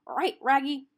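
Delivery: gruff cartoon dog talk; cartoon dog speaking; Scooby-Doo dog voice. A narrator's reading. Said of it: "Scooby's reply".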